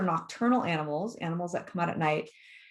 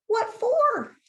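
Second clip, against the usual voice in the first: high, loud storybook reply; loud puzzled possum; called-back question; 6 dB louder than usual. A narrator's reading. Possum answers the shouted warning.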